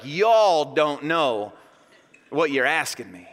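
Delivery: drawn-out Southern "y'all"; Southern drawl. Acted. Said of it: "whipping out his southern drawl".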